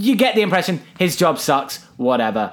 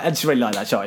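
ordinary delivery

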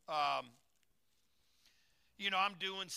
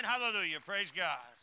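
ordinary delivery

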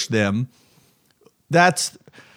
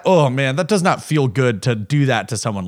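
usual speaking voice

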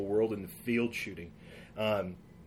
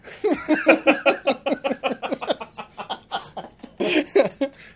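long, loud, high fit of laughter; helpless laughter; 9 dB louder than usual. Real, not acted. Listener baffled by the farm joke, long loud laughing fit. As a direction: laugh until you can't stop.

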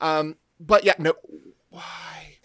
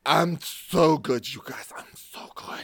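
put-on slurred drunken bragging; 4 semitones lower than usual. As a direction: slur it like a drunk. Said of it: "that's my drunk voice".

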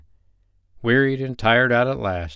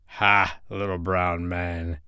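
low, slow, quiet menace from the storm wind; wicked laughing storm wind; 4 semitones lower, 5 dB quieter, slower than usual. A narrator's reading. The storm wind gloats over the sleeping sprite, low and slow. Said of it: "laughed the storm wind wickedly".

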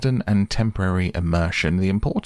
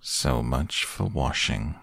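lower, slow, quiet deadpan donkey; gloomy Eeyore; 5 semitones lower, 4 dB quieter, slower than usual. Acted. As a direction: sigh it out slowly. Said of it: "said Eeyore".

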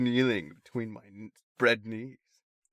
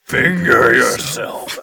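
slow, louder mock demon command; 9 dB louder, much slower than usual. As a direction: drag it out like a demon. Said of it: "you a demon".

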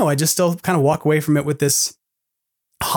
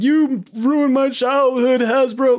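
high, slow, hammed-up wail; mock outrage; 9 semitones higher, slower than usual. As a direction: overdo the wounded fan, drawn out. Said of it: "to be like".

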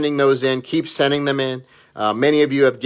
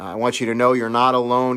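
usual speaking voice

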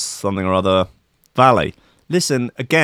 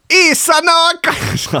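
put-on high, loud, drawn-out Italian accent; hammed-up Italian accent, stretched out slowly; 5 dB louder, slower than usual. Comic reading out a farm chief's quote, Italian accent. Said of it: "do an Italian accent".

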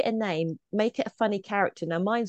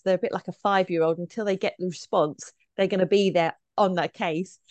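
ordinary delivery

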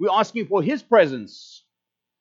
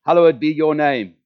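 leading prayer recitation; 5 dB louder than usual. Shown as a demonstration. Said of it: "Starting with me saying".

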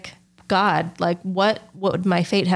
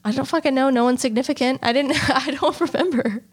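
laughing it off; 6 semitones higher than usual. Real, not acted. Laughing off a question about the soulmate.

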